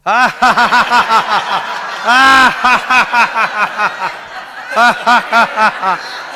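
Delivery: long, high, loud forced laughter; loud fake laugh. Shown as a demonstration. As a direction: laugh hard on purpose with full effort. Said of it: "Fake laugh".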